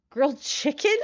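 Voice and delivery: usual speaking voice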